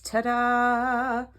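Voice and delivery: bright, high ta-da; proud showman's ta-da; excited reveal. Real, not acted. Reveals the finished card.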